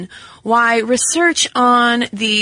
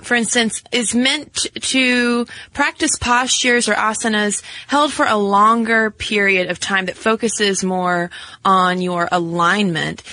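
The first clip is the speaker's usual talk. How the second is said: ordinary talk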